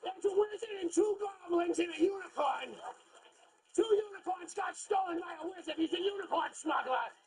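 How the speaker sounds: put-on high Boston accent, hollered in alarm; hammed-up Boston-accent yelling. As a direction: cry out like a Bostonian sounding the alarm. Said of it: "It wouldn't work with the Boston accent".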